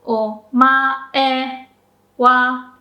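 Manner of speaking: Omae wa: wa stays high after mae; flat pitch accent. Shown as a demonstration. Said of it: "So the wa stays high".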